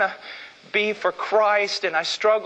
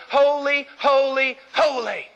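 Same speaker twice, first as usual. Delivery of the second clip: seraph crying out; 6 semitones higher, slower than usual. Retold or imitated. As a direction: call it out like angels across the temple. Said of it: "and one cried unto the other".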